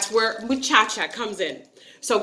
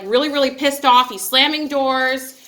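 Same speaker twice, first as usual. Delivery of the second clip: ordinary talk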